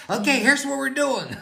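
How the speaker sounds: high, laughing take-charge voice; bossy fiancée laughing. Retold or imitated. Fiancée takes charge after the proposal, high laughing voice. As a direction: mimic her taking over the plans, high and giggly.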